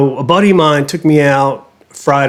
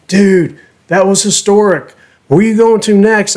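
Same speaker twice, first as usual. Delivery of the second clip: put-on excited young guys; 6 semitones higher than usual.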